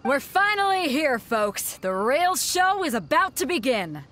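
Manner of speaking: rally the crowd for the big show; arena announcer. Acted. Announcer welcoming the crowd, lively hype.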